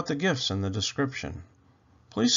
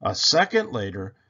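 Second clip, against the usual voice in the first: ordinary delivery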